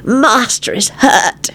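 slow, high gloating moan; happy moan. A narrator's reading. Weezer beaming at injured Lupin, moaning happily. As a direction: moan it slowly with delight. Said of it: "he moaned happily".

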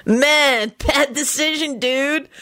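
high-pitched slacker-dude voice; knowing dude. A narrator's reading. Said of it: "Kenny said knowingly".

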